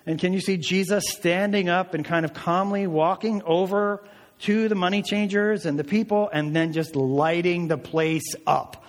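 usual speaking voice

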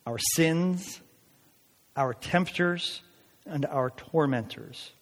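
slow, weighty quotation; solemn slow reading of a commentator; slow quote read. Real, not acted. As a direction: lay each phrase down deliberately. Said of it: "And he said this".

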